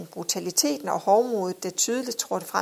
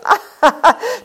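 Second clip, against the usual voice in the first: loud, high acted belly laugh; hearty laugh; 13 semitones higher, 10 dB louder than usual. A narrator's reading. Husband laughing off his wife's reproach, loud guffaw.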